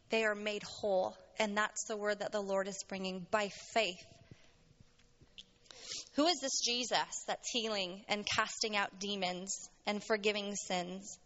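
usual speaking voice

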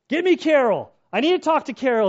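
loud, high demanding call for Carol; half-sedated patient barking at nurses, loud and high; barking orders. Retold or imitated. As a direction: bark it out, groggy from anesthesia. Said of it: "I'm barking orders".

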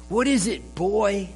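lightly voiced jokey butcher; joking butcher. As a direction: tease the dog like a jolly shopkeeper. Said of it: "the butcher jokingly asks".

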